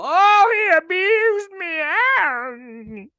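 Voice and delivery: hammed-up high, loud wail; exaggerated tearful mockery, dragged out high; mock crying Amber Heard. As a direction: whine it long and fake, like a sobbing victim. Mocking Amber Heard's abuse claim.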